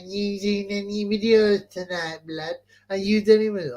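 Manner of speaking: high, slow, squeezed weaselly caller; put-on high weasel voice. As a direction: imitate a weaselly prank caller, dragging the words. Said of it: "I can hear your fucking weasel voice".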